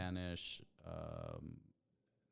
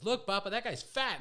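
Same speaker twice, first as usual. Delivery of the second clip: put-on high, loud little-kid voice; mimicked blurting child; 11 semitones higher, 8 dB louder than usual. Son pointing out a stranger to papa, loud mimicked child.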